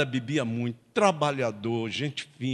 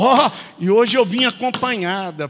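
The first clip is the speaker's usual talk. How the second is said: put-on higher, louder drunken announcement; drunk announcing; 9 semitones higher, 11 dB louder than usual. Drunk husband telling wife he brought company, loud. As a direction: call it through the door.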